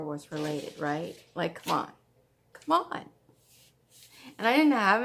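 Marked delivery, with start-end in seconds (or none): 2.5-3.1 excited. Real, not acted. Stitcher insisting on a Star Wars project, excited. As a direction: throw it up high like you can't resist. Repeated much higher in excitement.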